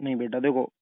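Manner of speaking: normal talking voice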